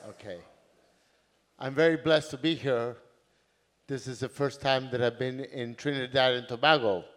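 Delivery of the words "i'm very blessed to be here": normal talking voice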